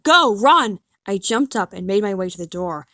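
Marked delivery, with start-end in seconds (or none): 0.0-0.8 loud, higher urgent yell; screaming. Retold or imitated. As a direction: scream it to hurry everyone off the bus. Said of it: "he started screaming at everyone".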